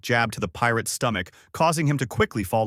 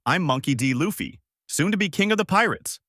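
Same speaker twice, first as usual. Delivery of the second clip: higher-pitched, bold self-introduction; proud declaration; 7 semitones higher than usual. A narrator's reading. Luffy facing Alvida, naming himself, proud declaration. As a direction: announce it proudly, voice lifting high.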